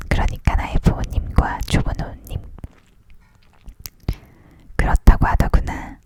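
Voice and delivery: usual speaking voice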